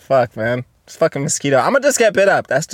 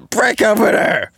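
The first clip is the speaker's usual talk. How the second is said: shouted advice; 5 semitones higher than usual. Acted. Outraged advice.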